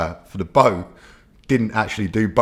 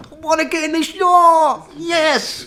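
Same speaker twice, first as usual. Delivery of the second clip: put-on high, loud childlike glee; excited little kid; 6 dB louder, slower than usual. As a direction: squeal like a kid who just got a yacht. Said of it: "the excitement of the little kid".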